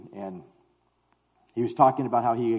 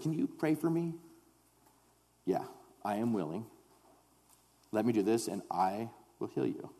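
hushed, understated imitation with halting pauses; mock healer exchange; 8 dB quieter than usual. Preacher imitating a believer claiming to heal, hushed mock assurance. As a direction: softly mimic a plea and a self-assured reply. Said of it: "none of us ever goes to a place like".

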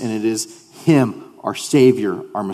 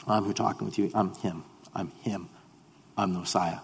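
softer, tender voicing of Jesus; sweet and gentle; 3 semitones lower, 12 dB quieter than usual. Jesus revealing himself to the woman, softer and tender. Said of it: "by the sweetness of this Holy Spirit".

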